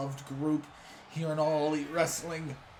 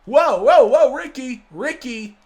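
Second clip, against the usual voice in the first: loud, high whoa to cut him off; loud call-out; 7 semitones higher, 12 dB louder than usual. Real, not acted. Sammy storming in to cut off Ricky's promo, loud high call-out. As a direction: barge in and stop him talking.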